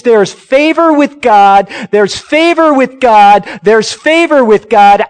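full-force preaching. Acted. Preacher proclaiming favor with God to his church. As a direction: proclaim it to the whole room.

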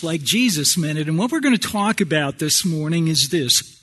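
ordinary talk, nothing marked

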